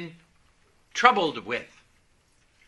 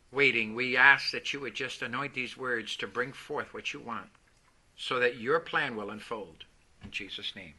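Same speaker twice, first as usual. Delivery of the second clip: quiet, low, prayerful voice; bow your head and pray softly; 10 semitones lower, 6 dB quieter than usual. Acted. Opening prayer before the sermon.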